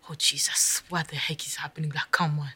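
hushed, retold disbelief; quick exasperated whisper to Jesus; alarmed whisper. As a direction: whisper it under your breath, spooked. Scared by dogs barking, retold.

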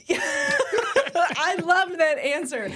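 laughing through the words. Real, not acted. Host laughing at her cohost's answer, real laughter. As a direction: laugh it out, delighted.

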